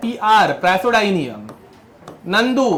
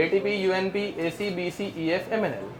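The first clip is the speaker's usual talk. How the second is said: softer, run together without gaps; flowing recitation; 9 dB quieter than usual. Shown as a demonstration. Teacher showing students the mnemonic's flow, softly run together. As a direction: let the letters flow quietly as one chain. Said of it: "ऐसे एकदम flow है इसमें".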